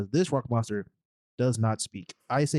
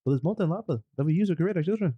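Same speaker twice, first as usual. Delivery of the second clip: higher, quick mock Kiwi rock guy; mock Korg voice; 6 semitones higher, faster than usual. Friend riffing on Korg, higher quick mock Kiwi voice. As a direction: riff in a light Kiwi rock voice.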